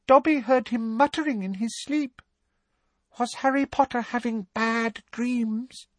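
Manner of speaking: very high, concerned elf voice; a worried little elf peering up; timid elf squeak. Acted. Dobby worrying about Harry, high and timid.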